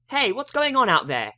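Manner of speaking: high, loud call from the back room; Ruby calling out. A narrator's reading. Ruby calls from the back room, high and loud. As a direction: shout it from another room, puzzled. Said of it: "asked Ruby's voice".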